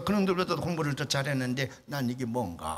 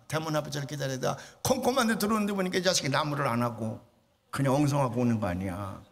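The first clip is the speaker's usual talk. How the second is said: usual speaking voice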